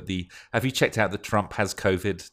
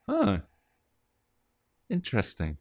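mildly intrigued. Retold or imitated. Recalling an odd stoned thought, mildly intrigued. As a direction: mull it over with idle curiosity. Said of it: "It was just like".

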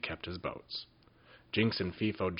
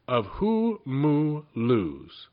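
very slow, drawn-out, eerie; mysterious wizard; 5 semitones higher, 5 dB louder, much slower than usual. A narrator's reading. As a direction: savour the strange name. Wizard names mysterious caves.

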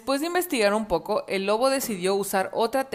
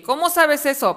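higher, louder annoyed wolf; irritated wolf caught out; a touch of angry wolf voice; 6 semitones higher, 6 dB louder than usual. Acted. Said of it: "preguntó el lobo enojado".

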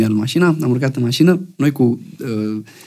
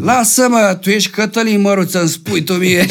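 higher-pitched mock protest; insistent tipsy mayor; 5 semitones higher than usual. Tipsy mayor refuses the singer's correction, mock insistence. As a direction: brush off the correction as the stubborn mayor. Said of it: "Domn primar, eu sunt".

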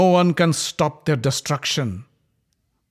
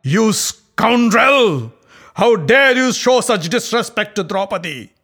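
much higher and louder, slow furious roar; bursting with rage; 7 semitones higher, 8 dB louder than usual. Acted. Bhima raging at Duryodhana's insult, roaring. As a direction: roar it out in fury. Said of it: "Bursting with rage".

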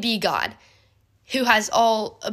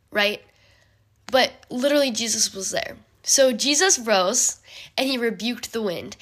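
normal talking voice

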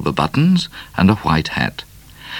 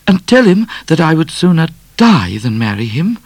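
high, louder refusal; high indignant Miss Laura; indignant lady; 11 semitones higher, 4 dB louder than usual. A narrator's reading. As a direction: refuse with pride. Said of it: "Miss Laura refusing".

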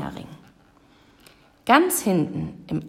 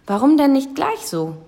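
pleased little witch, pitched up and louder; a contented, higher-voiced "about time"; satisfied; 7 semitones higher, 5 dB louder than usual. A narrator's reading. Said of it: "sagte die kleine Hexe zufrieden". (zufrieden, mit gehobener Stimme)

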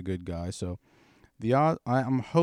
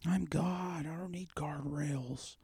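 put-on high, breathless drunk whisper; mock whisper; 9 semitones higher, 3 dB quieter, slower than usual. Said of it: "You're like".

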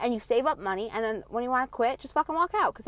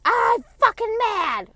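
much higher, loud, slow furious mom voice; mom mad; 13 semitones higher, 10 dB louder, much slower than usual. Retold or imitated. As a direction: bellow it like a raging mother. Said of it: "she'll just come in like".

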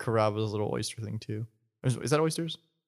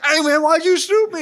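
high, loud comic protest; hammed-up outraged yelp; mock wounded suspect; 9 dB louder than usual. Shot suspect protesting to the cop, high mock wail.

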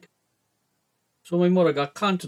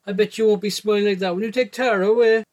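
louder and higher, a furious jab; angry retort; 5 semitones higher, 5 dB louder than usual. Acted. Boy confronting Bridie about taking Tara away, angry raised voice. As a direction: spit it at her, mad and raised. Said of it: "I was mad at her and goes".

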